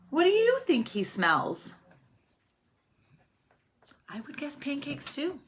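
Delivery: usual speaking voice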